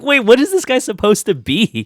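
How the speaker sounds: loud, high-pitched incredulous question; baffled outburst. Retold or imitated. Baffled viewer reacting to the actor's muddled accent, loud and incredulous. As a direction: exclaim it in mock bafflement.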